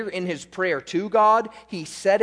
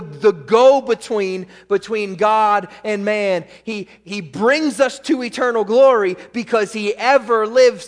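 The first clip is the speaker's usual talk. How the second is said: raised, loud pulpit delivery; full-force preaching; 4 semitones higher, 7 dB louder than usual. Real, not acted. Preacher proclaims Christ as go-between, full-force preaching.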